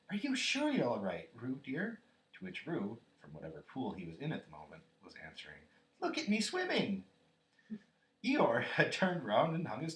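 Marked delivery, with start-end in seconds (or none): 0.1-2.0 high, loud worried question; raised, fretful mother's voice; anxious motherly call. A narrator's reading. Kanga calling anxiously from bank. 6.0-7.1 high, loud proud squeal; piping child's shout; squeaky Roo. A narrator's reading. Roo answering from the pool.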